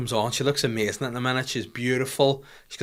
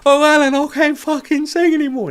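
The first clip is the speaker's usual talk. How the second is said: much higher, louder whining plea; 8 dB louder than usual. Retold or imitated. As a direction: wail it like a diva giving up. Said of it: "And then she's like".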